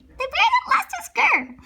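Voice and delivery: high announcing voice; storybook title voice. Acted.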